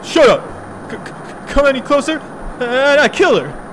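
high, loud stammering threat; panicky threat. Acted. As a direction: shout it high, tripping on the first word. Kidnapper threatens to kill hostage.

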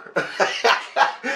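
high breathy laughing fit; burst of laughter. Real, not acted. Storyteller cracking up at his own tale, high laugh.

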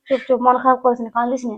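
low, soft consoling; soothing hush. Acted. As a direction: gently hush someone who is crying. Hushing a crying sister.

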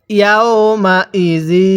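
very slow, drawn-out syllables; model recitation. Shown as a demonstration. Teacher models Quran words for learners, slow measured recitation. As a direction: stretch each long vowel evenly. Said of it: "তাহলে পড়ুন".

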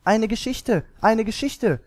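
put-on high, eager children's cry; children calling out. A narrator's reading. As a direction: call for it like clamouring children. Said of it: "riefen die Kinder".

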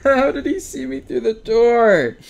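high, loud screaming protest with a laughing shriek; panicked shout. Real, not acted. Gamer screaming as the monster comes through the door.

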